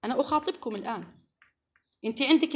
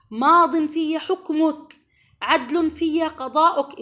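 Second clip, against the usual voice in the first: loud, slow, pleading supplication, pitched higher; fervent prayer recital; 4 semitones higher, 7 dB louder, slower than usual. Acted. Preacher reciting the grief prayer to her sisters, loud and slow. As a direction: cry each phrase out to God, slowly. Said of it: "هذا دعاء الهم والحزن".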